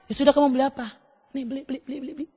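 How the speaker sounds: quiet, resigned parent caving in; giving in. Acted. Father gives in to crying child, softly yielding. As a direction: give in under your breath, repeating it quickly.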